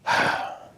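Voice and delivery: long unvoiced exhale; heavy sigh. Real, not acted. Storyteller pausing before the hard part, sighing. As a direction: let out a weighty breath.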